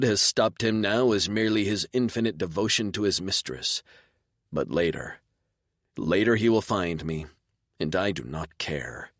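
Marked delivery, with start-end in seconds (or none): none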